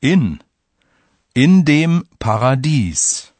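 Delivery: slow, careful demo reading; slow and clear. Shown as a demonstration. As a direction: say it slowly for learners. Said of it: "Listen to two examples".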